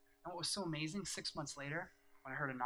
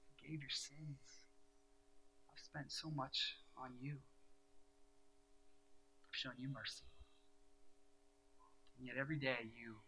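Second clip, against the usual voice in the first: soft voice of God; 6 dB quieter than usual. Retold or imitated. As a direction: speak as a tender, patient God. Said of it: "in that soft voice that God speaks".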